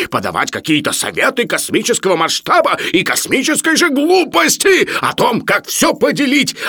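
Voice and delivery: high, loud, mounting indignation; furious tirade. Acted. Professor berates Sharikov for his crude advice, furious. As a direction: hammer out each charge with outrage.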